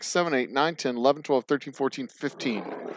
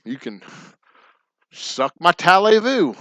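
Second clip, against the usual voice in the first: a cocky, lightly higher parting jab; mock taunt; 3 semitones higher than usual. Texan insulting Francois, cocky mock taunt. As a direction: toss off the insult with a smirk.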